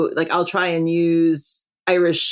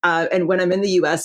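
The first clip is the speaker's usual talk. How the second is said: ordinary talk, nothing marked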